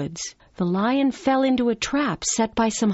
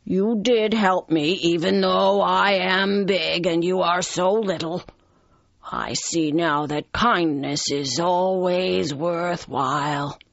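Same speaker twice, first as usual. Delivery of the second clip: gentle lion. Acted. Lion admitting kindness from the little mouse, gently. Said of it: "said the lion gently".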